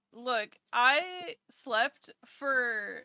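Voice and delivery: very slow, dragging words; slow sleepy drawl. Real, not acted. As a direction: stretch it out sleepily. Drawn-out complaint.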